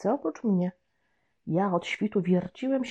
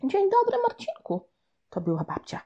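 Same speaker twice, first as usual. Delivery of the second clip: grandma asking; 9 semitones higher than usual. Acted. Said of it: "spytała".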